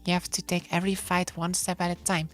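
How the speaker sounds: ordinary talk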